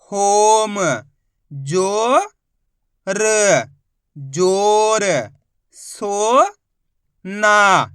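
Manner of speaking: loud, drawn-out syllables, each sounded separately; a teacher chanting sounds for kids to repeat; sounding out Hindi syllables for a class; slow phonics drill. Shown as a demonstration.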